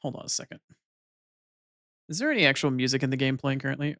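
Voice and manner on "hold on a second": hushed whisper aside. Real, not acted. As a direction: whisper it away from the mic. Muttered aside off-stream.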